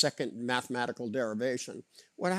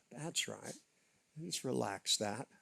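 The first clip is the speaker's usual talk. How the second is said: soft spa whisper; 7 dB quieter than usual. Acted. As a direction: soothe the tension away. Hypnotic relaxation on knot.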